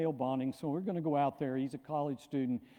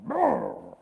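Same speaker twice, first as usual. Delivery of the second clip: crowd roar imitation; 9 semitones higher, 6 dB louder than usual. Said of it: "the whole room erupted".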